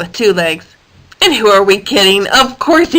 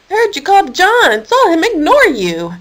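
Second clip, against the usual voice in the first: indignant; 8 semitones higher than usual. Acted. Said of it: "Keith said indignantly".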